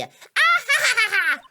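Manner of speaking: gloating laugh. Acted. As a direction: laugh in triumph. Gloating laugh after verdict.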